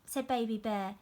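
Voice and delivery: put-on lively storybook narration for young pupils; story reading to children. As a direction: read it out like a teacher at story time. Reads picture book aloud.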